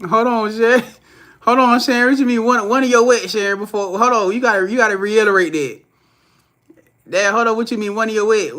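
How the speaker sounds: laughing hype at a viewer's comment; excited. Real, not acted. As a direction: rush it, demanding she explain. Reacting to a chat comment.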